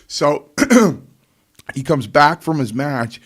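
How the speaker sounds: normal talking voice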